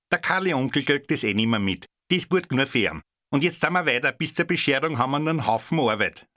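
high, fast brusque housewife; harried shopper dismissing the gift; fat woman. A narrator's reading. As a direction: rattle it off like a busy matron. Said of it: "sagte die dicke Frau".

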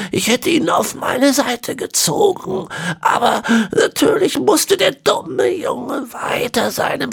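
put-on high, slow, whispery old man; ancient villain. A narrator's reading. Ezekiel explaining the horse bones, high and whispery. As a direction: rasp out the words like a centenarian.

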